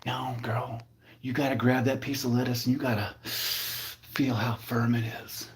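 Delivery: soft, hushed come-on; smooth-talking produce-aisle guy. Pretend. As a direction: murmur it like a playful pickup line. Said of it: "he's gonna be like".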